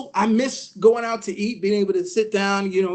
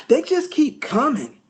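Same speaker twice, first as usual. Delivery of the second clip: higher, slow mock complaint; exasperated worker; 5 semitones higher, slower than usual. McDonald's lady grumbles about customers, mock exasperated. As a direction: gripe aloud, dragging the words.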